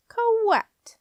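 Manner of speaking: high, loud, irritated squeak; begrudging doorkeeper forcing it out; very reluctant. A narrator's reading. Irritated doorkeeper admitting the answer, reluctant. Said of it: "he said very reluctantly".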